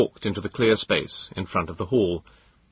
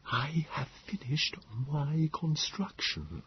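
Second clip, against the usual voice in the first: slow, much softer alien hush; Morlock whisper; 7 semitones higher, 11 dB quieter, slower than usual. A narrator's reading. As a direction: confide a secret plan quietly.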